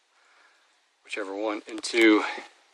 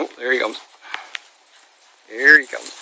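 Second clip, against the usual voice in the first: usual speaking voice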